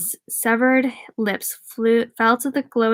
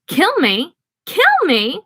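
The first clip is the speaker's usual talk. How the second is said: high rising clown shriek; clown's shrill taunting squeal; 7 semitones higher than usual. A narrator's reading. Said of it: "The clown shrieked laughter again".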